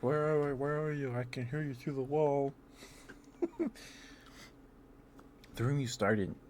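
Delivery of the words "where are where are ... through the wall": calling out. Retold or imitated. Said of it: "we're like".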